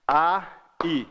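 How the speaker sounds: "a, i" as two separate beats; separated vowels "a", "i"; hiatus split. Shown as a demonstration. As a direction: break cleanly between "a" and "i". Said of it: "Aí é hiato".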